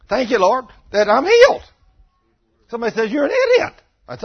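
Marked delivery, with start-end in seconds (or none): 0.1-1.6 high, loud shout of praise; exultant thanks. Retold or imitated. Exultant prayer over sick leg. 3.1-3.7 much higher, louder mocking voice; mock jeer.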